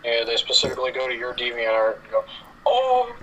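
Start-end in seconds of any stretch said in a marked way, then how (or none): 2.7-3.2 high, loud blowfish-style "Oh!"; puffed-up pufferfish noise. Retold or imitated. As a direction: puff up and squeal "Oh!". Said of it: "make the blowfish noise".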